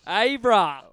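big hyped announcer call; excited shout. Acted. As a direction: yell the show name out loud. Hosts shout the show's name.

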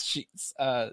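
ordinary talk, nothing marked

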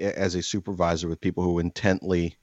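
normal talking voice

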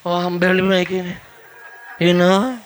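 limp, defeated monotone; put-on feeble wimp voice. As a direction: complain like a wilted noodle.

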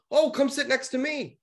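high-pitched imitation of a friend; high mock-sociable voice; inviting friend. Voicing the friend's eager invitation.

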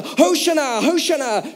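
high-pitched mock chant of acclaim; imitated crowd shout. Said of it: "everyone would be shouting louder and louder".

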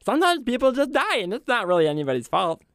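very high, innocent little cleric voice; squeaky sweet matter-of-factness; Rolda. Shown as a demonstration. Grave cleric explaining death, high cinnamon-roll voice. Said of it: "My kobold cleric".

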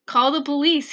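loud urgent order. Retold or imitated. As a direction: tell her firmly to call the police. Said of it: "he said immediately".

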